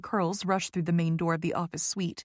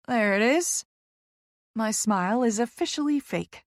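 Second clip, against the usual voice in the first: plain reading voice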